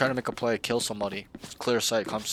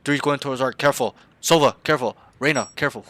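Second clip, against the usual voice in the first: loud, raised urgent warnings; exaggerated callout; 3 semitones higher, 8 dB louder than usual. Shown as a demonstration. Coach acting out loud callouts for his teammates, urgent. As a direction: call it out hard to the team. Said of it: "I'm over-exaggerating a little bit".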